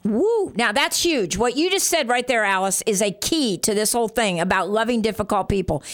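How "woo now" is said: ordinary talk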